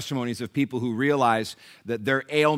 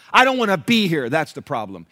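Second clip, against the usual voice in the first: louder, higher acted outburst; heated retort; 6 semitones higher, 9 dB louder than usual. Nelson answering the fellow patient, heated retort. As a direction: fire back, retelling a heated moment. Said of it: "in a moment of heated response".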